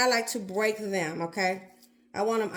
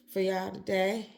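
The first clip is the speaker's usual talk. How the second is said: ordinary delivery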